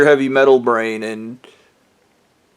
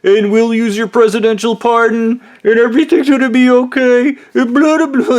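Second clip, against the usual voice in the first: mock voice rising higher as it goes; mocking retell; 9 semitones higher, 3 dB louder than usual. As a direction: parody his promises with a lightly raised whiny voice. Retelling the blue-eyed guy's pitch.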